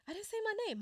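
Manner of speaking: surprised. Real, not acted. Realises she skipped her introduction.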